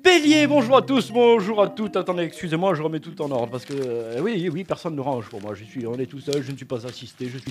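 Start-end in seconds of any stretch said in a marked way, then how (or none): 0.1-2.0 bright TV horoscope greeting; astrologer host. Acted. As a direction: greet viewers like a cheesy TV astrologer. Parody TV astrologer hosting.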